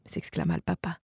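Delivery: ordinary delivery, nothing marked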